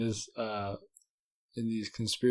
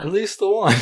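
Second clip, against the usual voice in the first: laughing; 10 semitones higher, 13 dB louder than usual. Real, not acted. Laughing about the next episodes.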